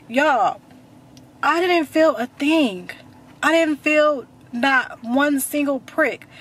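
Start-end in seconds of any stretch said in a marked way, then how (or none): 1.4-6.3 slow, higher, every word stressed; drawn-out, higher-pitched emphasis; emphatic disbelief. Real, not acted. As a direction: spell it out slowly in disbelief. Emphatic amazement at feeling nothing.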